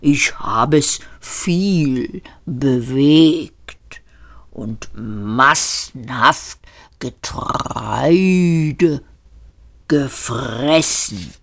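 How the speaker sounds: langsam, gedehnte Rinderstimme; höfliches Rind. A narrator's reading. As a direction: ein freundliches Schlachttier, das sich anbietet. Das Tier spricht. (das Hauptgericht stellt sich den Gästen gemächlich vor)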